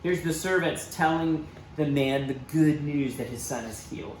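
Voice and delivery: usual speaking voice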